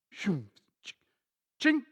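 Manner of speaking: whoosh cut short by a metallic ching; mouth-made whoosh, then sudden clink; digger hitting rock. Retold or imitated. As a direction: imitate blades clanging on stone. Mimics digger striking rock.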